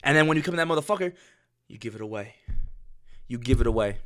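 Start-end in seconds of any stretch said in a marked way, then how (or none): 1.7-2.3 much softer, almost under the breath; soft aside. Shown as a demonstration. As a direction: drop the voice right down on the first take. Repeats line, first said softly.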